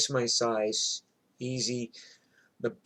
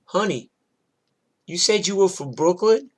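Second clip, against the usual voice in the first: higher, questioning imitation of the neighbor; neighbor's challenging question; 6 semitones higher, 5 dB louder than usual. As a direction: play a big lady calling someone out, pitched up. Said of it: "Carmen goes".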